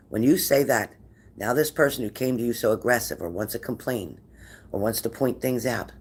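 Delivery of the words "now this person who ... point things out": normal talking voice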